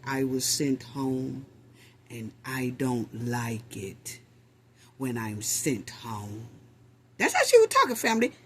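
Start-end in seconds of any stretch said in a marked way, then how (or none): 0.1-6.5 hint of a sinister voice; mock dark voice. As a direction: lower and softer, ominous imitation. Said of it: "hear her voice turn dark".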